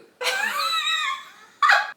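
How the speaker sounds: squealing laugh, sharply high; real helpless laugh; high burst of laughter. Real, not acted. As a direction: let a shrieking laugh out. Reacting to Medusa line.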